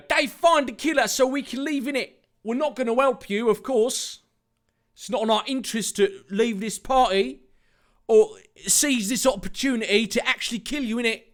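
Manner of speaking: high, quick put-on voice; taunting Jack character. Jack telling Dave to find the killer, taunting. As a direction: rattle it off high and fast.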